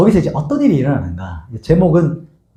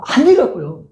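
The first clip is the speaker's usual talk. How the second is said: put-on insisting girl; 9 semitones higher than usual. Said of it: "그랬더니 로데가".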